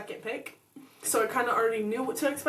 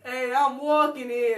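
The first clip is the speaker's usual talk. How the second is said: slow, called-out New York accent; New York City accent; 3 dB louder, slower than usual. Shown as a demonstration. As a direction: try a New York City shout on demand. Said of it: "do your New York City accent".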